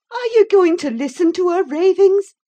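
high, loud scolding; heated, agitated reproach; passionate outburst. A narrator's reading. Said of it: "I said passionately".

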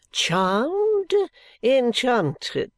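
high, drawn-out gushing; effusive dandy. A narrator's reading. Dandy receiving his guests, effusive. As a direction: gush it grandly, pitch swooping up.

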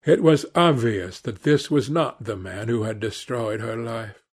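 ordinary delivery, nothing marked

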